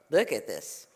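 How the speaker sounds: low, understated murmur of surprise; marvelling rabbi. Acted. Rabbi surveys the crowded house, low amazement. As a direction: drop the pitch and take it in.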